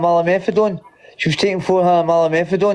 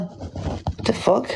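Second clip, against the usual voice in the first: breathy under-the-breath what the fuck, much softer; whispered swear; 5 semitones higher, 7 dB quieter, much slower than usual. Real, not acted. Swearing to himself at the wrong button, whispered. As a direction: mutter it to yourself, barely voiced.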